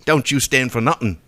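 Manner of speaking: put-on half-hearted British accent; a strained British accent winding down; mock British accent; forced British accent. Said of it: "trying so hard to get into a British accent".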